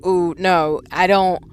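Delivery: ordinary talk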